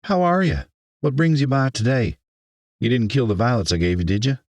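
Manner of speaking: high, chatty Southern woman; Southern accent. A narrator's reading. Florist welcomes the mayor, in a Southern accent. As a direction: greet a friend in a Southern twang. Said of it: "an accent so southern".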